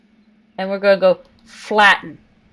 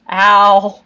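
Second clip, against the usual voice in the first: loud hammed-up yelps of pain; mock pain; 8 dB louder than usual. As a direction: yelp as if you just fell. I let go of my climb.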